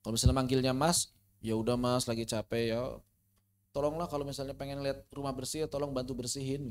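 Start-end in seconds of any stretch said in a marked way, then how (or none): 1.4-6.7 low, soft plea to a husband; hushed, tender request in a lowered voice; gentle wife. Acted. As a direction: act out a wife asking softly and sweetly for help. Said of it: "Harus, harus lembut".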